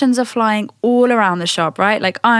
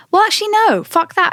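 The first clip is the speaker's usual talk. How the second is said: sudden self-correction; 10 semitones higher, faster than usual. Real, not acted. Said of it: "sudden self-correction".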